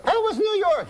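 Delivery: falsetto French wife; high woman's voice. Acted. Wife asks uncle about the trip, high falsetto woman's voice. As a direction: pitch it way up as the wife. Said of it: "high voice".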